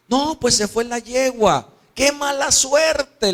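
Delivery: high-pitched, loud complaint; desperate son. Acted. Son answering his father about the lost mare, desperate. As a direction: lament it like an exasperated youth. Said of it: "el joven desesperado".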